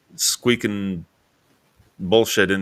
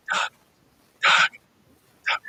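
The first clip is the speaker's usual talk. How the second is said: imitated whisper calling a name; much slower than usual. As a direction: whisper it out, as if calling through the garage door. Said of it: "She was just whispering".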